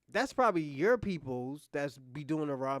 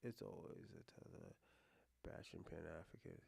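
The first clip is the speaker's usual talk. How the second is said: much lower and much softer, reading the message to himself; quiet reading; 12 semitones lower, 20 dB quieter than usual. Real, not acted. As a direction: murmur the chat line under your breath. First read of a chat message.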